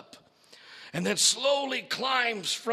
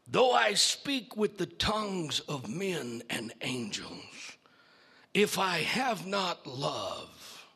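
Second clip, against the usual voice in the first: lower, slow, menacing creature; low hostile voice; 3 semitones lower, slower than usual. Acted. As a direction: speak slowly with cold hostility. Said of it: "in a low and hostile voice".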